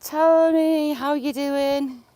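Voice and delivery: high, loud sing-song hello to a bird; cooing greeting. Real, not acted.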